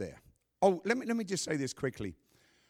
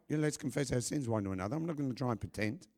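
ordinary talk

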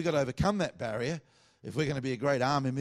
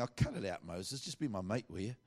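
casual Australian; 4 semitones lower, 6 dB quieter than usual. Retold or imitated. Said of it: "if he was an Australian saying".

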